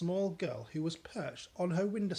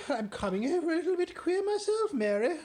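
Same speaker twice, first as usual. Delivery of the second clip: much higher, louder, quicker; shaken Queen; 13 semitones higher, 7 dB louder than usual. Acted. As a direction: wobble into fright.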